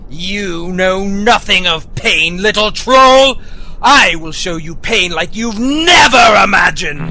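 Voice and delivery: villain losing his temper, screaming; furious shout. Acted. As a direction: roar the threat at the prisoner. Torturer explodes in rage.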